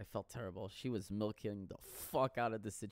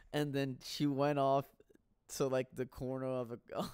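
usual speaking voice

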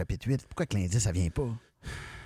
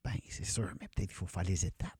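whisper; 2 semitones lower, 9 dB quieter than usual. Real, not acted. Whispered reply in spooky game.

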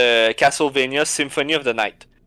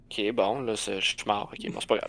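soft, resigned muttering under breath; deflated mutter; 5 semitones lower, 12 dB quieter than usual. Retold or imitated. Player muttering to himself after dying again. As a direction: quietly shrug off another death.

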